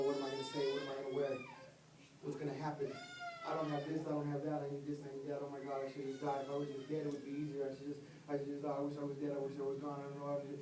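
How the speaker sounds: low and quiet, a hint of tears in the worry; hopeless inner thoughts, softly voiced; despairing inner voice. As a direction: mutter the spiral of dread to yourself. Voicing a despairing inner monologue.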